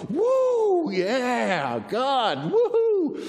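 high, loud whooping; hammed-up victory cheer; celebratory cheering. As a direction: whoop it up in mock triumph. Cheering Elijah's awesome moment.

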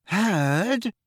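high rising puzzled question; confused. A narrator's reading. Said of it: "in a confused voice".

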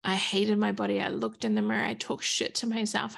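ordinary talk

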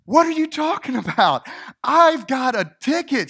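high, loud, disbelieving protest; indignant traveller retold at a high, loud pitch; outraged protest. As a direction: demand answers from the gate agent. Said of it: "she was completely outraged".

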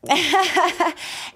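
high raspy cackle, loud; real raspy witchy laugh; witch cackle. Real, not acted. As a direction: cackle like a witch through a lost voice. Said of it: "I literally sound like a witch".

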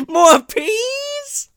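very high squeaky echo; silly squeaky echo. Retold or imitated. Host echoes friend's squeaky quote, laughing. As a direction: echo the line in a goofy squeak.

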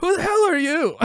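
very high-pitched heckling cry; mock heckler. Heckler challenges the comedian onstage, high mock heckle. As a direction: play an indignant heckler calling at the stage. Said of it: "he's just met with hecklers".

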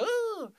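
very high little whine; imitated puppy wince. Little dog wincing but not hurt, imitated whine. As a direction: give a small high puppy squeal. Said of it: "it was just like".

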